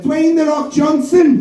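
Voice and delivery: put-on high, slow childlike voice; little girl. Little girl answering the host, high and drawn out. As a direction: draw it out like a small girl guessing. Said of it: "this little girl went".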